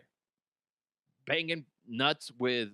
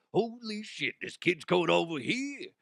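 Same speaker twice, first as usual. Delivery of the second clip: Al Pacino; 4 semitones higher than usual. Retold or imitated. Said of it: "I can't even do Al Pacino".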